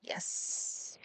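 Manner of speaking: a soft whispered yes of relief. Real, not acted. Streamer glad someone filled the request, whispered. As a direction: breathe the yes out.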